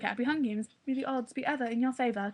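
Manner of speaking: light imitation of a cute British accent; a hint of a sweet British accent, gently quoting. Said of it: "she has this really cute British accent".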